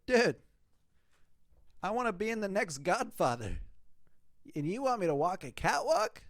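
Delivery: high, exasperated disbelief; incredulous retort. Retold or imitated. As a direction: scoff at the idea of modelling. Retold rant at photographer.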